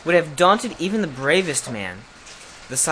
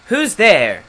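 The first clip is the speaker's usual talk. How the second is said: loud, raised angry challenge; hoarse, angry; 5 semitones higher, 9 dB louder than usual. A narrator's reading. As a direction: demand it angrily as a fierce beast. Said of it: "in a hoarse, angry voice".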